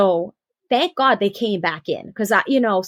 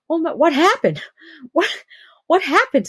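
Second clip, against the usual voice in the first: much higher, astonished, with a laugh; stunned nurse; 8 semitones higher, 3 dB louder than usual. Retold or imitated. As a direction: gasp it out in disbelief. Nurse stunned by the fast birth.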